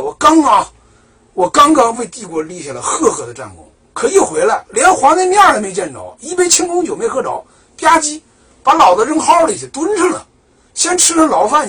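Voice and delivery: the jailed general griping, loud and aggrieved; indignant complaint. Retold or imitated. Narrator voicing the wronged general's indignant rant. Hou Junji's grievance voiced angrily.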